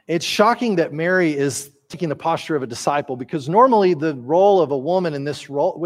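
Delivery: ordinary delivery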